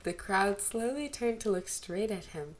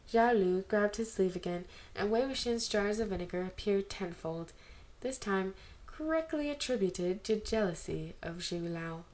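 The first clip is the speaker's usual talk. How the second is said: ordinary delivery, nothing marked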